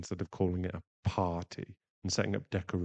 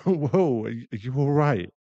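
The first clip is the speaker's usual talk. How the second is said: high, loud startled check on them; alarmed concern; 6 semitones higher, 12 dB louder than usual. Acted. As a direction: burst out, checking they're okay. Listener chokes on the drink.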